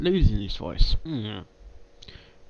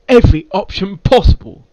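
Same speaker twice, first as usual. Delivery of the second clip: character line read; 5 semitones higher, 10 dB louder than usual. Shown as a demonstration. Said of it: "See, I can say it now".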